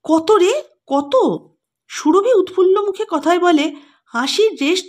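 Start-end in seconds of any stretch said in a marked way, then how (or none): 0.0-1.1 higher, pressing and eager; can't wait to hear the price; excited demanding ask; eager curiosity. A narrator's reading. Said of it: "উগ্র কৌতূহলে বলে".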